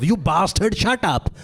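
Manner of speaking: high mock yell, Indian accent; stage routine outburst in Indian accent; angry Indian accent. Comic reprising his stage routine, mock-angry Indian accent. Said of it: "of my routine of".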